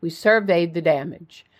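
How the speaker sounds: plain reading voice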